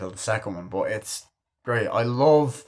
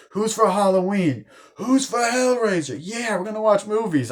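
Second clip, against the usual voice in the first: high, loud party hype; hammed-up excited calling out; mock rallying shout; 12 semitones higher, 6 dB louder than usual. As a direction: imitate whipping up a party crowd. Randy rallying party to watch movies.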